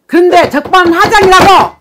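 loud, high and slow, full of outrage; indignant outburst. Real, not acted. 바람난 남편 얘기에 분개하며 내담자에게 외치는 큰 소리. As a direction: spit out the injustice, dragging each syllable. Counselor outraged at client's husband.

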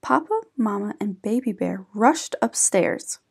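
plain reading voice

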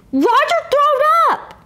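high tattling holler. Acted. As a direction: shout the news. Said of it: "I hollered out".

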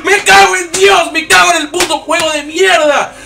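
furious high screaming curses; raging gamer. Real, not acted. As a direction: scream at the game, losing it.

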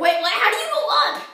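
high, loud baffled outburst; loud bewilderment. Real, not acted. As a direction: call out, suddenly lost in the game. Confused and stuck mid-race.

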